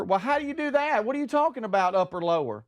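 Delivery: high, bewildered mom voice; confused mom. Retold or imitated. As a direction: ask it high and lost. Mom confused by case sensitivity.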